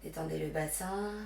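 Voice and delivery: hushed, unhurried meditation guidance; guided relaxation. Real, not acted. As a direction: guide them gently into breath and rest. Leads yoga relaxation and breathing.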